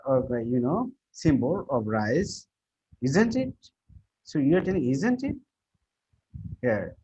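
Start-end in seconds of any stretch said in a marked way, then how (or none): 3.0-3.5 isn't it with the voice swooping much higher; rising tag question. Shown as a demonstration. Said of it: "the portion you are raising your voice". 4.9-5.4 isn't it with the voice swooping much higher; rising tag question. Shown as a demonstration. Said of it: "the portion you are raising your voice".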